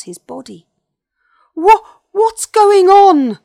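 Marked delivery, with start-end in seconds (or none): 1.5-3.4 high, very loud, stammering alarm; big bully suddenly panicking; worried. A narrator's reading. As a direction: cry out in drawn-out confusion. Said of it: "sounding worried".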